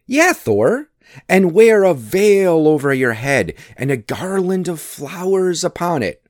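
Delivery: high, mocking lilt; gleeful teasing. A narrator's reading. Loki describing Thor's bridal veil, gleefully teasing. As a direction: pile on details to goad him.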